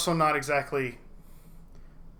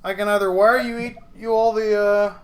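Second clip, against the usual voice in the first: lightly voiced character, raised and louder; Simon; 5 semitones higher, 6 dB louder than usual. Simon offers the runners payment, higher and louder. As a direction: pitch it up and push the volume a little. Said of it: "he goes".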